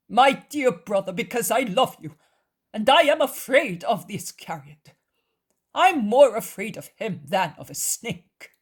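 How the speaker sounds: high, loud, emphatic plea; fervent cousin. A narrator's reading. Cousin confessing fear of Judas, fervent plea. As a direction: plead urgently with someone you love.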